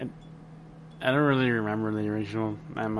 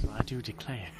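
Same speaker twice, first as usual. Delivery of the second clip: mock Southern drawl; 9 dB quieter than usual. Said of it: "She should have had a Southern accent".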